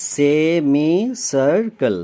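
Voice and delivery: very slow "semi circle" in four separate beats; teacher sounding out "semi circle" very slowly; syllable by syllable. Shown as a demonstration. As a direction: break "semi circle" into its four syllables, each held long. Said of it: "চারটে syllable আছে".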